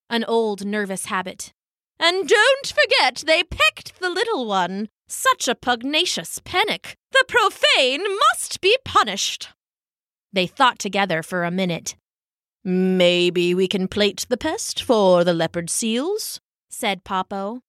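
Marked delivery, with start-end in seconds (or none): 2.0-9.5 put-on high, huffy old penguin hen; outraged on the little one's behalf; indignant elder penguin. Elder female penguin demanding punishment, high and indignant. 12.6-16.4 put-on slow, thoughtful old penguin; lightly voiced musing elder; ponderous elder penguin. Elder male penguin pondering the thief, slow and musing. Popo suggests.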